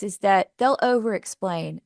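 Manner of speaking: ordinary talk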